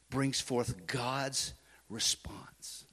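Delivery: slow, soft whispered emphasis; hushed, deliberate sermon whisper. Shown as a demonstration. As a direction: lean in and whisper it slowly.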